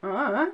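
"Uh," grunted with the mouth barely open, meaning I don't know; Suffolk grunt. Shown as a demonstration. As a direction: say "Uh," as one short lazy closed-mouth grunt. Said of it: "Suffolk people tend to go".

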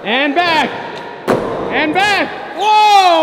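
projected cue rising to a high yell; calling out. Real, not acted. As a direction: call it across the park, building to a whoa. Calling out the skater's passes.

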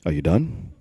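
low, soft, unhurried question; imagined voice of God, hushed and deep; God waiting patiently. Acted. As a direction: drop deep and quiet, patiently asking. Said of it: "I can feel God being like".

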